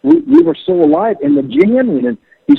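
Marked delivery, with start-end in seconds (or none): 0.0-1.2 high, eager retelling of a friend's enthusiasm; excited insistence. Retold or imitated. Bandmate insisting to his doubtful friend, excited. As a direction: imitate excited insistence, pitch leaping high. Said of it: "I couldn't believe the excitement in his voice".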